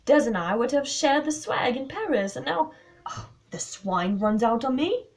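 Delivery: furious rant. Acted. Furious blonde raging that her partner deserted her, angry. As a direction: rage at the partner who ran off. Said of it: "clearly in a furious temper".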